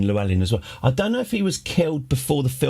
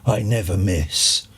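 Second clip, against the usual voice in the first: lower, slow, lightly voiced deadpan Bond; Bond's cool one-liner; 3 semitones lower, slower than usual. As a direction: deliver the kill line flat and cool, a hint of Bond. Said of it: "and then goes".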